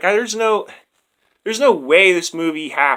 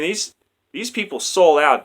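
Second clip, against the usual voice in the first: ordinary delivery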